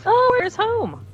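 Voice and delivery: high, slow, eager question; light, wide-eyed interest; sweet country girl asking; bright curious. Acted. Player character, bright.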